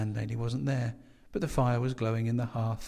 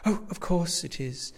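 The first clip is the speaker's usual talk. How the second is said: higher, drawn-out slow exclamation; crying out; 6 semitones higher than usual. A narrator's reading. Landlady corrected on guest's name, mildly crying out. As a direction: exclaim with mild sudden recognition. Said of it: "she cried".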